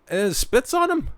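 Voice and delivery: squeaky high disbelieving question; incredulous. Real, not acted. Host reacting in disbelief to the news report, squeaking high. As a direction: throw the pitch way up in disbelief.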